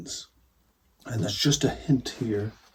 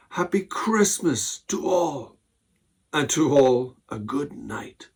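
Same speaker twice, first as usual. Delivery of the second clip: 1 exclaiming; 5 semitones higher, 6 dB louder, slower than usual. Acted. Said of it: "I heard him exclaim".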